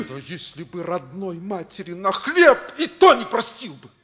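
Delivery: loud, high furious reproach; angry rebuke. Acted. Teacher rebukes the boy for stealing, loud anger. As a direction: lash out at him in anger.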